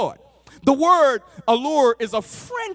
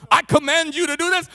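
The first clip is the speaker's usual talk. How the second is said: louder, higher, brisk divine order; commanding pronouncement; 5 semitones higher, 5 dB louder, faster than usual. Acted. Preacher mimics a usual divine pronouncement, mock commanding. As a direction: proclaim it like a stern decree. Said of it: "pronouncements are usually".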